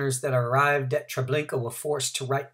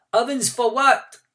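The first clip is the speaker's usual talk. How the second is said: called out; 11 semitones higher, 7 dB louder than usual. A narrator's reading. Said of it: "came another voice".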